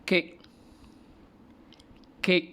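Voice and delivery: "Kek" high and short; review repetition. Shown as a demonstration. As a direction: sebut "Kek" dengan jelas. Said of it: "Cikgu ulang balik".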